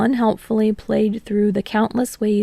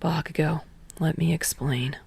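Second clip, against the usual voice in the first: softer, steady and calm; 4 semitones lower, 8 dB quieter than usual. Acted. Shinso calming Kotsky down, soft steady voice. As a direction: soothe him, trained calm. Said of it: "Shinso's voice was calm".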